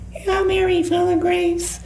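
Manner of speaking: put-on very high, small child's voice; childlike voice. Demon speaking to the priest through the woman, in a child's voice. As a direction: sing it out like a little child. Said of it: "spoke to me like a child".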